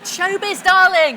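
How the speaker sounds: high, loud luvvie drawl on darling; camp showbiz. Pretend. Host teasing the guest about showbiz, camp luvvie voice. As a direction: ham up a theatrical luvvie. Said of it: "camp showbiz phrase".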